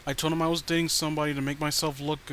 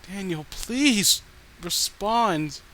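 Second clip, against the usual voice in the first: slow, higher, louder wailing please; drawn-out plea; 5 semitones higher, 6 dB louder, much slower than usual. Acted. Obsessed girlfriend begging Daniel to answer, slow wailing plea. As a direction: stretch every word in a desperate wail.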